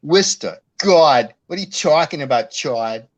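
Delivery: put-on loud Worcester Massachusetts accent, no r at the end of there. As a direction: yell at Chad in a thick Worcester accent. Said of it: "I couldn't even do an impression of that".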